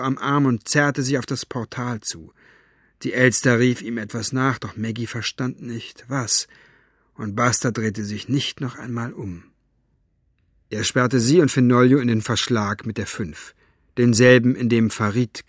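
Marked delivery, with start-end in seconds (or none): none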